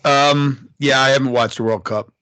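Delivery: blasting into the mic; loud, over-strong answer; way too loud. Real, not acted. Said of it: "he's way louder than me".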